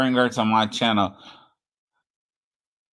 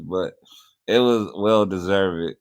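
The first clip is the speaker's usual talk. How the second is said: normal talking voice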